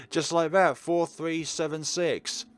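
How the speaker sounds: loud, high and elated; triumphant. Real, not acted. Player showing off the cracked code, triumphant. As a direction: call out the winning code, slowing on the digits.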